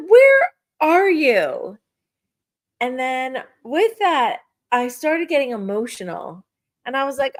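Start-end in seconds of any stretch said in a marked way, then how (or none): 0.1-1.8 slow, high call into the empty kitchen. Retold or imitated. Alone in kitchen, calling out to her late dad, slow and loud.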